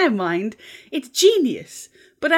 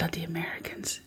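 unvoiced scornful whisper; muttered whisper; 15 dB quieter than usual. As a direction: grumble it under your breath. Mock insult aside.

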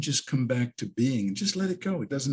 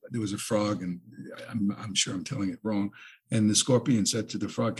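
ordinary delivery

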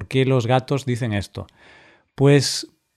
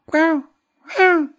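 very high, yappy little bark; shrill toy dog yap; high-pitched bark. Shown as a demonstration. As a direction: yap like a tiny annoying dog. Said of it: "hay estos así agudos".

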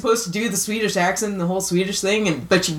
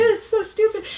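much higher, giggly voice; helpless laughing; 13 semitones higher than usual. Real, not acted. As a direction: still cracking up, barely getting it out.

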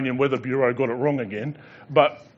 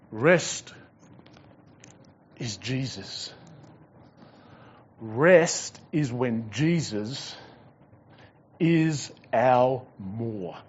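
very slow, measured, lower; slow, hushed climax of the sermon; slow emphatic; 3 semitones lower, 5 dB quieter, much slower than usual. Real, not acted. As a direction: let each word land slowly.

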